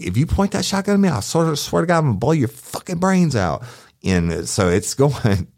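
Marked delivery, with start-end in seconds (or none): 0.0-3.6 high, rushed threat that settles down; desperate threat. Retold or imitated. Cop retelling threatening to shoot the armed woman. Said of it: "I'm like".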